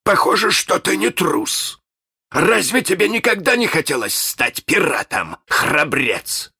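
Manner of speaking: put-on high-pitched villain sizing up a boy; pirate captain. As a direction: coax the boy in a captain's theatrical voice. Pirate captain voiced in narration.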